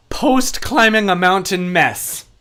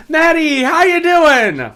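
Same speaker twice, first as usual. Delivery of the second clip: higher, slow cheerful greeting; 8 semitones higher, 5 dB louder, slower than usual. Real, not acted. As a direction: greet them warmly and brightly. Greeting a viewer in chat.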